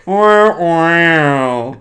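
mock baby wail "wah, wah"; baby crying. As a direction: imitate a toddler crying.

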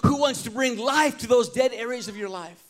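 fervent sermon peak; preaching at full force. Acted. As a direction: build the appeal with rising intensity. Preaching builds to climax.

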